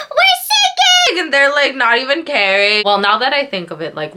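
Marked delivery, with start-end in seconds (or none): 0.1-1.2 high, slow panicked scream; screaming for help. Retold or imitated. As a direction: scream it to the other boats. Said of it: "I'm like".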